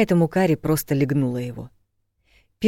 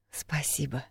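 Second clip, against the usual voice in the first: softly murmured, far quieter; murmured thanks; 11 dB quieter, slower than usual. A narrator's reading. Woman nestling on his shoulder, murmuring. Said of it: "пробормотала она".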